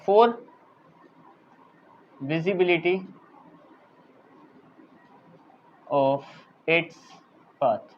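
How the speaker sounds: word by word, with long pauses; dictating. Real, not acted. Teacher dictates notes to students, word by word. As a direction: dictate it in separate pieces as you write. Writing out words while dictating.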